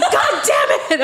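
slow high yelled curse; yelling. Retold or imitated. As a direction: drag out an angry yell. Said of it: "I'm like".

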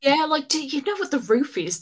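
as if Casey should obviously know this; Raph's baffled delivery. Retold or imitated. Retelling the film, she mimics Raph's baffled question about the roof. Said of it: "it's Nolan North's delivery of it".

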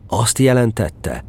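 ordinary delivery

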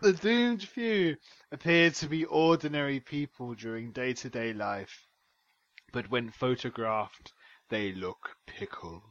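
scary voice. Acted. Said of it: "coming down into your scary voice".

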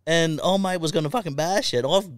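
high, hammed-up mate voice; mock indignant mate; mate explaining. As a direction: mimic the battered mate's complaint. Mate retelling the fight.

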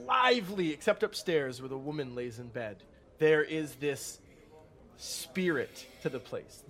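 ordinary delivery

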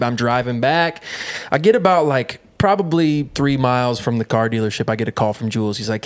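normal talking voice

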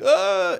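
mock wail. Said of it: "it wasn't like".

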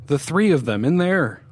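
high, loud, urgent; desperate to be believed; urgent insistence. A narrator's reading. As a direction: insist in alarm, pitch jumping. Frightened narrator insists on the voices.

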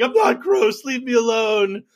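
petulant, wounded protest; Rudy protesting to the prosecutors, mock whine; whiny Rudy. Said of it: "Rudy's like".

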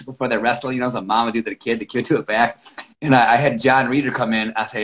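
ordinary talk, nothing marked

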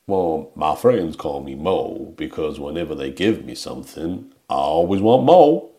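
put-on accent, starting low and rising to a punchline; Mo's accent. Podcast host delivering Mo's one line, imitated accent. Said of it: "actually a good impression of his accent".

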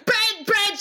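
high, eager outburst; gleeful high-pitched insistence; excited shouted answer. Pretend. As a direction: shout your choice right back. Guest answers the shouted question.

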